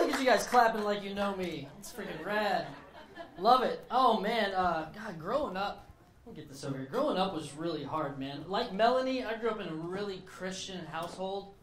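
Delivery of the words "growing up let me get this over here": usual speaking voice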